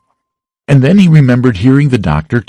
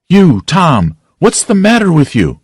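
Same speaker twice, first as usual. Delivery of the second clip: gasped out; 8 semitones higher than usual. Acted. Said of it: "she gasped out".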